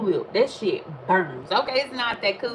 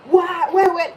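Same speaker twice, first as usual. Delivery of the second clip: high, loud mock yell; 10 semitones higher, 6 dB louder, slower than usual. Imitating the man yelling at the universe, high and loud. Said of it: "I shouldn't have to yell".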